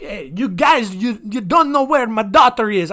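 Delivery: hammed-up Austrian dad at the police; mock Austrian accent. Father nagging police in mock Austrian accent. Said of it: "I don't know what accent that is".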